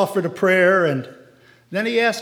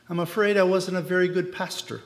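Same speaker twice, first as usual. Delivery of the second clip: plain reading voice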